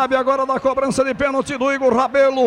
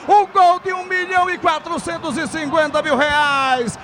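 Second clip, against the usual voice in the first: excited miss call; 3 semitones higher than usual. Real, not acted. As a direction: yell it, stretch the words. Penalty missed, shouting.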